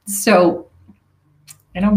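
ordinary talk, nothing marked

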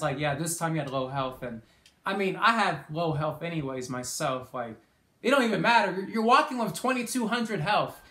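normal talking voice

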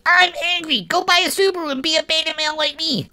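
high, whiny mock gamer voice; whiny angry gamer. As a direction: parody a petulant gamer throwing a tantrum. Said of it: "from angry players".

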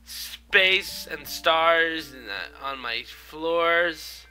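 slow, loud, drawn-out proclamation; mock grand boast. Player showing off a space-themed room, mock grand boast. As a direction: announce it grandly, stretching the words.

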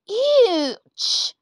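a polite little boy, voiced lightly; young George. George reacts.

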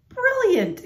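very high-pitched burst of admiration; delighted high exclamation; exclaiming. A narrator's reading. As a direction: exclaim it high, like a thrilled storybook mayor. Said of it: "exclaimed Mayor Meyer".

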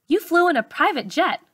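a hint of a kind doctor sharing a surprise; doctor's voice. A narrator's reading. Doctor explains to young patient.

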